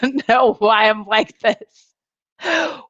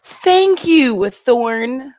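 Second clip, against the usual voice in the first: high, loud thank you; excited thanks to a viewer; 5 semitones higher, 5 dB louder, much slower than usual. Real, not acted. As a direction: gush thanks.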